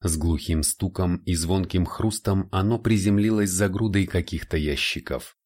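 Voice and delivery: normal talking voice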